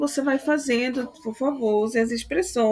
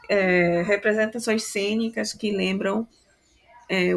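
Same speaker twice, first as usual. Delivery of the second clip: normal talking voice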